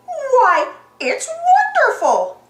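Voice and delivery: high, loud, drawn-out gush; swooping high cartoon delight; delighted Clarabelle. A narrator's reading. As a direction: gush loudly and slowly.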